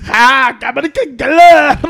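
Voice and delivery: loud, slow, drawn-out cry; mock shout. Man recalling the first thing in his head, hollered. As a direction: stretch it out into a comic holler. Reenacts the blurted phrase again.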